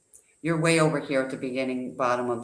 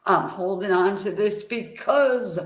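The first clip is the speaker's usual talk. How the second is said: mock excuse voice; 5 semitones higher than usual. Mocking a listener's excuse.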